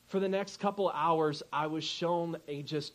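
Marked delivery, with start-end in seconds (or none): none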